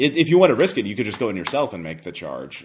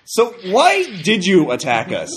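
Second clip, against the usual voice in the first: higher, louder questioning voice; suspicious superhero; 6 semitones higher, 5 dB louder than usual. Acted. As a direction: press them hard, half amused. GM voices hero interrogating zombie.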